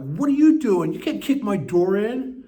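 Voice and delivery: high, loud indignant comeback; retold outburst, high and drawn out at the end; acted outraged protest. His acted outraged answer to sponsor.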